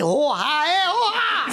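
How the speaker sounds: high cries breaking into laughter; imitated dying cry. Repeating the dead man's last words, laughing through cries. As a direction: redo the death wail while cracking up. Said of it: "Il a dit".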